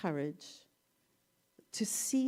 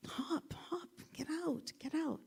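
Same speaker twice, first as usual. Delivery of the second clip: high, hushed frog call; 7 semitones higher, 7 dB quieter than usual. Retold or imitated. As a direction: squeak it up from the ditch's rim. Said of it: "he's like".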